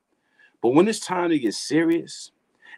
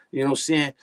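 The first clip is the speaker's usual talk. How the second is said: ordinary talk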